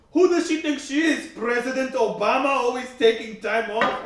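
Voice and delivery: loud, high-pitched angry rant; pissed-off caller. Retold or imitated. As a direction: imitate an irate caller yelling down the phone. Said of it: "pissed off".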